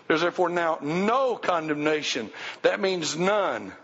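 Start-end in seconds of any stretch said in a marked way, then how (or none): 0.1-2.3 "no" held long as the peak; stress on NO. Shown as a demonstration. Preacher repeating that it means none, stressing "no". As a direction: hit "no" and stretch it out. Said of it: "Now put emphasis on the word no".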